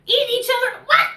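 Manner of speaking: very high, loud disbelief; incredulous counselor. Retold or imitated. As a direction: squeal out the disbelief. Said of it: "She's like".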